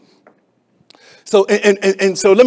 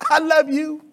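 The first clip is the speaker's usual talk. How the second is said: higher, sing-song churchy I love you; mock pious tone; 9 semitones higher than usual. Preacher mocking churchgoers' empty words. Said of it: "we know how to say all the Christianese".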